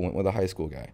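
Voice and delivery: ordinary talk, nothing marked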